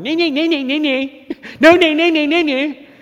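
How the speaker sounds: high mocking chant of nays; jeering crowd. Preacher imitating the crowd jeering at Pilate. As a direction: jeer it like a heckling mob. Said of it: "I can hear the crowd going".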